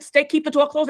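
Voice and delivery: acted-out alarmed insistence; urgent order. Arguing at the door in hurricane.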